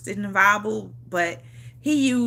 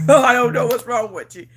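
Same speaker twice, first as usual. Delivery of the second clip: laughing; 5 dB louder than usual. Real, not acted. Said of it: "repeats line laughing louder".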